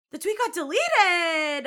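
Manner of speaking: horrified that the clip is gone; shocked gasp. Real, not acted. Vlogger finding the tweet deleted, gasping in shock.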